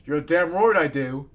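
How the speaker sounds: loud, slow yawned reply; yawning. A narrator's reading. Wounded guest agrees he needs rest, yawning loudly. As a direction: stretch it out through a yawn. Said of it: "I yawned".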